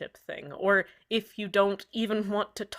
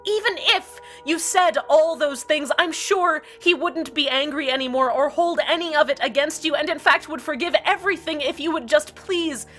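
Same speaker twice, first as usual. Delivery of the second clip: higher and louder, heated sarcastic tirade; bitter rant; 7 semitones higher, 6 dB louder than usual. Acted. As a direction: spit out every insult in a rising fury. Host rants bitterly about fight.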